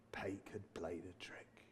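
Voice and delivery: breathy storyteller's whisper, slow; whispered reveal. A narrator's reading. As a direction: let the secret out in a hush. Dramatic reveal to the audience.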